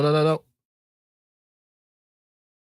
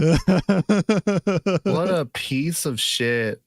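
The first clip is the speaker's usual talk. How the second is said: drawn-out mock insult; much slower than usual. Friend repeating the insult, dragged out slowly. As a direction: stretch every word out slowly.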